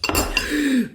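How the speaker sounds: quick high startled gasp; sharp gasp. Real, not acted. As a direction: gasp at a little kitchen mistake. Reacts to own mistake.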